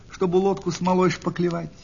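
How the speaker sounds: usual speaking voice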